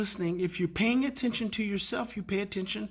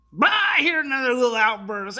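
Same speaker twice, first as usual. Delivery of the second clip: higher, louder storyteller burst; animated retelling; 4 semitones higher, 7 dB louder than usual. Retold or imitated. Telling of the kid's outburst, animated and loud. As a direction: launch into the outburst with a jolt.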